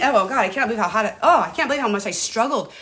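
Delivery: ordinary talk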